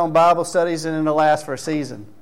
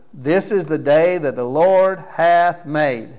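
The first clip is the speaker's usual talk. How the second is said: slow solemn verse reading; 4 dB louder, slower than usual. Acted. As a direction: read the verse slowly and loudly, weighing each word. Reading the church's chosen verse.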